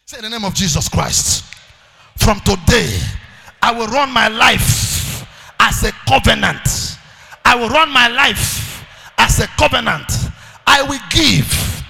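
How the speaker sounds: rousing call-and-response; preaching at full force. Acted. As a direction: thunder it out for them to repeat. Leads a declaration at full force.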